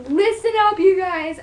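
mock yell. Shown as a demonstration. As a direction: shout it at the camera like a drill sergeant. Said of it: "I feel the need to, like, yell at you guys".

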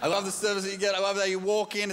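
ordinary talk, nothing marked

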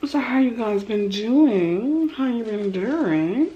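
slow sing-song greeting, pitched high; playful greeting. Real, not acted. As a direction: stretch out the hello with swooping pitch. Drawn-out playful greeting to viewers.

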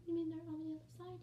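high, soft, rushed nervous voice; small anxious squeaky question; Daphne. Pretend. Shy pet asking about the viewers, nervous high voice. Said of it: "Daphne, let's calm down".

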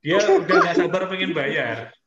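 loud, high, amused teasing; laughing tease. Real, not acted. Teacher teasing Chandra about wanting to pay, laughing.

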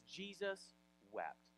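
JESUS WEPT slow and soft, pause between the words; solemn reading. Retold or imitated. Reading scripture at Lazarus's death, solemn reading. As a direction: read "Jesus wept" slowly and quietly.

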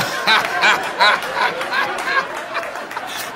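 high wheezy cackle trailing off; hearty laughter. Real, not acted. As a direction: burst out laughing after the punchline. Cracks up at own punchline.

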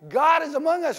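shouted, high, jubilant crowd cheer; excited crowd cheer. Acted. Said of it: "they're coming with Jesus all like".

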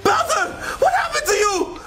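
high, urgent cry; alarmed. Acted. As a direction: call out in worried shock. Friend revives.

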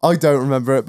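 usual speaking voice

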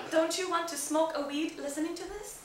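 usual speaking voice

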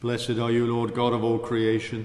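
usual speaking voice

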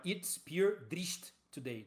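put-on "It's pure drift today" drawn out slowly in an Irish accent; slow exaggerated Irish accent impression. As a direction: stretch out "pure" in a thick Irish brogue. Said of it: "O cara fala".

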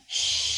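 sh held long, like a drawn-out s; long sh sound. Shown as a demonstration. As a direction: stretch out the hiss of sh. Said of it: "nó giống như âm s mà nó dài ấy". (âm sh kéo dài)

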